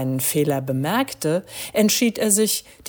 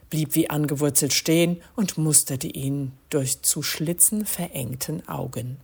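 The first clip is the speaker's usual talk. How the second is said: usual speaking voice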